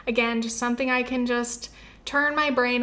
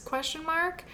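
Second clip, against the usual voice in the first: soft, higher questioning lilt; tentative, raised and quiet; unsure; 5 semitones higher, 7 dB quieter than usual. Real, not acted. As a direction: say it small, as a doubtful aside. Voices doubt about the date.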